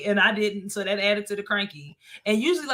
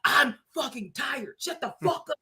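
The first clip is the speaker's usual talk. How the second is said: retold exasperation; 5 dB quieter than usual. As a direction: imitate your own worn-out fury. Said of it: "I almost slapped him".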